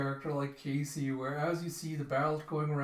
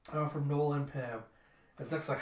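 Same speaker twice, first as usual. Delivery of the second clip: ordinary talk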